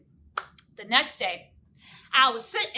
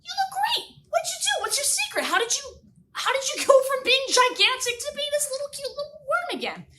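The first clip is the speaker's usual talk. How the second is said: much higher, excited gushing; amazed delight; 13 semitones higher, 4 dB louder than usual. Retold or imitated. Miss Irby gushing as Herman returns small and cute. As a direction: gush over his new look. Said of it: "And I think".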